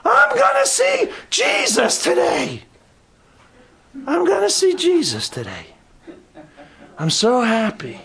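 put-on ecstatic elderly man longing for Jesus; high slowed old-man voice full of joy; joyful old man. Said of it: "He said".

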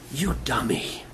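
a fond whisper; whispered you dummy. Retold or imitated. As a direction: tease him with a soft whisper. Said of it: "a vessel like that to say".

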